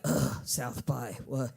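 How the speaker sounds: low, slow, sick-of-it groan; mock fed-up groan. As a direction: sound like a local who has had enough. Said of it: "natives will be like".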